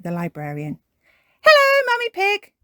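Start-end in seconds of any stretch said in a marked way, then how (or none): 1.4-2.5 very high, loud cartoon greeting; Miss Rabbit. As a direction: sing out a greeting at the top of the range. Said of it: "It's Miss Rabbit".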